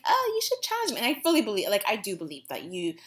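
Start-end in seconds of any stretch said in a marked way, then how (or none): 0.0-1.0 high, sweetly reasonable tone; imitating her own too-nice self; mock diplomatic. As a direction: put on a polite diplomatic voice. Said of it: "I was trying to be diplomatic and be like".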